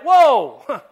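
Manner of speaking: loud laughing startled "whoa"; startled shout with a laugh. Retold or imitated. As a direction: jump back in shock, laughing. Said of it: "You'd be like".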